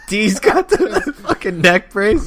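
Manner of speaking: high and slow through laughter; giggling out the line; laughing. Real, not acted. Laughing while recalling scene.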